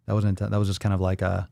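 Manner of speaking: usual speaking voice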